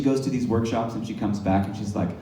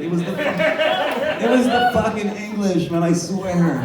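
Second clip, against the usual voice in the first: high, far-off indignant voice swearing it was the English; hammed-up aggrieved Irishman, pitched way up; mock Irish rant; 8 semitones higher, 3 dB louder than usual. To the Chinese ghost, a hammed-up outraged Irish voice.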